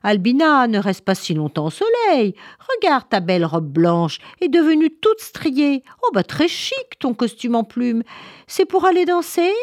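high, lively mocking busybody; teasing animal character. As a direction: tease her in a high, sing-song voice. Story animal teasing Albina. (voix aiguë et moqueuse)

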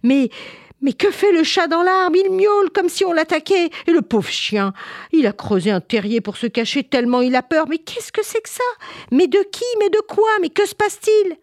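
high-pitched, alarmed string of exclamations; mother crying out. Acted. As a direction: cry out in frantic dismay. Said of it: "Maman crie les bras en l'air".

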